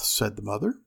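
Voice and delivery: plain reading voice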